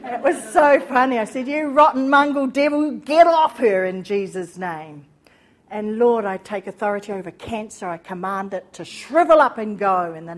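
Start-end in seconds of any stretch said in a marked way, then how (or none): 1.5-5.1 loud forceful rebuke of the devil; commanding rebuke. Retold or imitated. Rebuking the cancer as she prays over the lady, loud and forceful. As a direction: cast it out with authority. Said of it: "I said it just like that".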